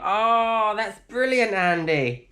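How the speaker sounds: high, drawn-out delighted reaction. Real, not acted. Praising Andy's van name suggestion, delighted. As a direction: burst out with real amusement, pitch way up.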